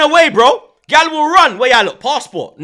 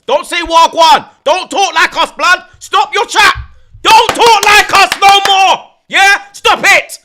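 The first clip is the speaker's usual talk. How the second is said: loud, slow ranting scold; mock rant; 5 semitones higher, 4 dB louder than usual. Scolding Africans for copying his slang, loud mock rant. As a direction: tell them off loudly.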